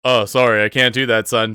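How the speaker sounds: loud, brisk acted refusal; guard calling back. Guard turns down a spectator's popcorn order, loudly. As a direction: call the refusal out over a noisy crowd. Guard answers over courtroom noise.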